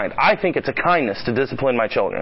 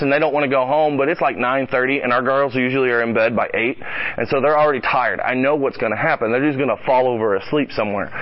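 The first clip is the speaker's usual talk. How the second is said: ordinary talk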